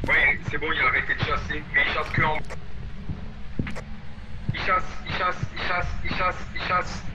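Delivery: soft relieved whisper; whispering while hiding. Real, not acted. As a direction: stay hushed while the danger fades. Hunt ending, still hiding.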